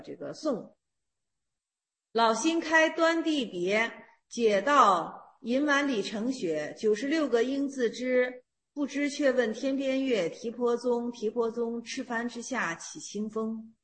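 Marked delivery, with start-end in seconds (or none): 2.1-13.7 slow, soft, measured chanting of a classical verse; verse recitation. Acted. Teacher reciting Xuedou's verse to students, slow and hushed. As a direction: intone each line slowly like a poem.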